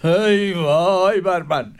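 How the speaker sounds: slow, drawn-out ای وای بر من; sorrowful lament. Acted. Caliph grieving on his throne, slow lament. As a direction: sigh out ای وای بر من slowly. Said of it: "با افسوس گفت".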